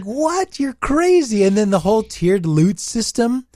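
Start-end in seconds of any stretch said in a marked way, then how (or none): 0.0-1.4 high, incredulous scoff; mock disbelief. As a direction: act stunned at the nonsense. Mocking reaction to the company.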